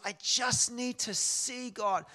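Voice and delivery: high, loud, slow plea, as if through tears; imitated tearful plea. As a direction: plead it tearfully, retelling her cry. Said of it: "With through tears".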